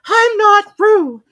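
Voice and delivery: very high, loud, slow squeaky protest. A narrator's reading. Piglet to Kanga, loudly denying he is Roo. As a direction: insist loudly, stretching it out. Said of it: "said Piglet loudly".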